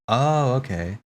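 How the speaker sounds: ordinary delivery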